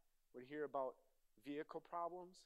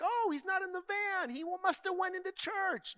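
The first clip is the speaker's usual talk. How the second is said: mom fooled; 14 dB louder than usual. Retold or imitated. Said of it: "my mom's gonna look in the van and be like".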